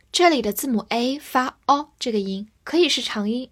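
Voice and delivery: ordinary talk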